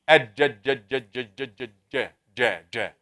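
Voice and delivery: ج sounded alone, again and again; sounding out jeem. Shown as a demonstration. Teacher drills ج sounds for students. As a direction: drill ج with the middle of the tongue. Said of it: "Give me the جيم".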